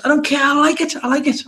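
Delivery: playful high-pitched I like it; sing-song high insistence. Pretend. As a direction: chant it high and stubborn. Childish insistence on liking the game.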